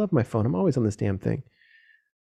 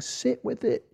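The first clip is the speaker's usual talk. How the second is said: much higher and softer, sing-song mockery; mocking hippie advice; 13 semitones higher, 6 dB quieter, slower than usual. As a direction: mimic a dreamy meditation guru. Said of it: "like some, like, hippie meditation thing".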